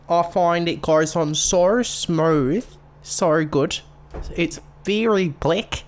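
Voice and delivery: loud, high hammed-up Aussie; hammed-up Australian beauty-vlogger accent; shitty Australian accent. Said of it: "shitty Australian accent".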